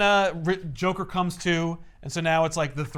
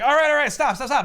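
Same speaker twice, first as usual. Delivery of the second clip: higher, louder begging; mock pleading; 7 semitones higher, 6 dB louder, faster than usual. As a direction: beg him to stop, hammed up. Riddler begs Batman to stop.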